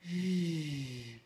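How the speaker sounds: "j" held long and low, voiced like a buzz; voiced "j" hum, lips rounded; isolated j sound. Shown as a demonstration. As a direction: sustain the sound "j" with teeth closed. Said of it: "je vais vous présenter aujourd'hui donc le".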